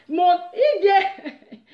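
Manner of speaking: high, loud, drawn-out words breaking into laughter; stretched mock blurt with a laugh; laughing imitation. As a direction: blurt it slowly through a laugh. Amused retelling of near slip.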